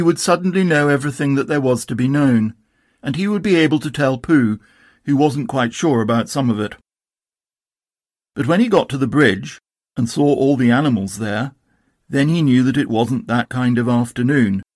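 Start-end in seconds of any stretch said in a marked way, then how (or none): none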